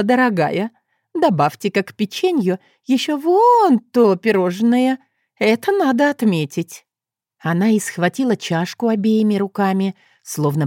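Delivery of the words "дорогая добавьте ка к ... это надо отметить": high, bright celebratory voice; excited. Acted. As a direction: treat yourself, it's a celebration. Customer celebrating prophecy. (радостно, празднично)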